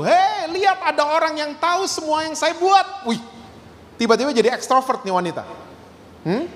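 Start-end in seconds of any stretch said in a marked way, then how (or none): 0.1-3.1 mock yell of amazement; imitated village shout. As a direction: cry it out high like news for the whole village. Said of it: "dia bisa teriak di kampung itu".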